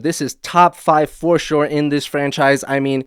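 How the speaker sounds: normal talking voice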